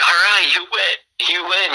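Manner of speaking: high, pleading mock surrender; Grant giving up. Grant caving to bleeding Rick, high pleading surrender. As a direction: squeak out a beaten give-up. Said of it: "Grant's like".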